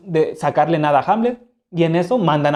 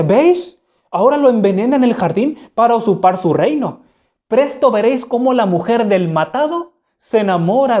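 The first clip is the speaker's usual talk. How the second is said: excited exclaim; 6 semitones higher, 3 dB louder than usual. Acted. Hamlet exclaims before the court.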